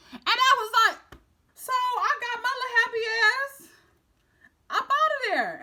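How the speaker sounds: high, loud, half-laughing flight; excited escape. Retold or imitated. Fleeing the scene laughing.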